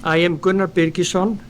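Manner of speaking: ordinary talk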